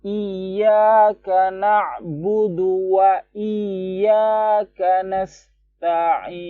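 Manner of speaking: slow, high chanted tilawah; Quran recitation. Shown as a demonstration. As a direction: recite slowly with a clear wa. (tilawah pelan dan tinggi)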